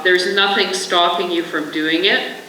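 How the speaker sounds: usual speaking voice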